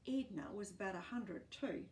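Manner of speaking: usual speaking voice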